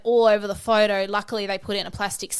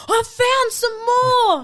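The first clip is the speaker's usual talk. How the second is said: very high, slow mock holler; excited calling out; 13 semitones higher, 4 dB louder, much slower than usual. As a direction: announce a find to the whole group. Retelling girl shouting to her group.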